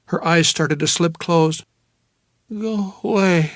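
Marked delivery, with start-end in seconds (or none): 2.5-3.5 slow, high and slurred; drowsy dismissal; sleepy woman. Acted. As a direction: mumble it, wanting sleep. Drowsy woman pushes him off.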